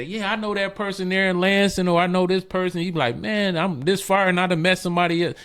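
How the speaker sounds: high, lively quoted voice; bright quoted surprise; quoting a new friend. Retold or imitated. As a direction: pitch it up like an excited stranger. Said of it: "then all of a sudden they say".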